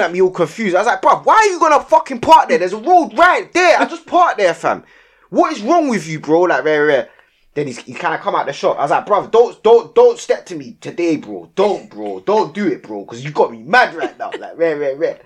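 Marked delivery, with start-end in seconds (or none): 1.0-6.4 yelling at the driver who parked on the crossing; retold angry yell. 9.1-14.3 warning the driver not to step to him; retold warning.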